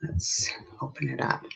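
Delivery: soft unvoiced aside; hushed aside while opening something; whisper. Real, not acted. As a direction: whisper it to yourself.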